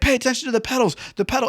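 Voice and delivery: high-pitched mock yelling; hammed-up shriek of command; screaming instructor. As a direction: imitate an instructor screaming orders. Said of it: "screaming, screaming".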